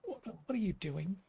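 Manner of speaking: softly imitated posh English accent; lightly put-on posh voice. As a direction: quietly mimic a posh English reply. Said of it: "In a posh accent".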